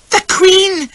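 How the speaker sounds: very high, loud excited squeal. Acted. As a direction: squeal with delight. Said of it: "she squeals".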